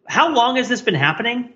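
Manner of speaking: usual speaking voice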